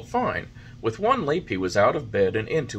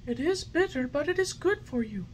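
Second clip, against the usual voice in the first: high, gentle fairy voice; fairy, patient. A narrator's reading. Fairy patiently coaxing the puppet. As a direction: soothe him kindly.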